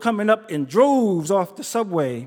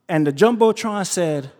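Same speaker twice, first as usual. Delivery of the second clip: ordinary talk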